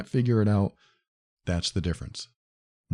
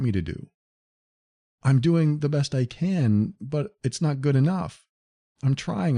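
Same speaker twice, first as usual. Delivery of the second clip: higher, helpless pleading voice; confused victim; 6 semitones higher than usual. Retold or imitated. Victimized partner describing endless trying, higher and helpless. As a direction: sound lost and trying hard, voice up. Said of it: "Someone who's being victimized usually says".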